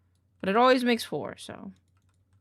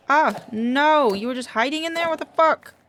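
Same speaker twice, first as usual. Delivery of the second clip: loud, higher startled outburst; startled shout; 6 semitones higher, 11 dB louder than usual. Real, not acted. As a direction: blurt it out in shock. Startled by hidden enemy.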